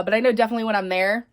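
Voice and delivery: normal talking voice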